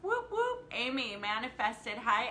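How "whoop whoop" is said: very high double whoop; playful party cheer, way up high; celebratory whoop. Real, not acted. Cheering a viewer.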